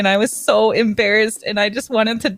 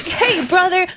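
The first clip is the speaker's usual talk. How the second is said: high, show-off little-sister voice; mock-eager bragging in a high voice; boastful kid sister; 11 semitones higher than usual. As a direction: brag to the crowd, pitched way up. Showing off as popular brother's little sister.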